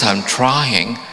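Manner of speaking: normal talking voice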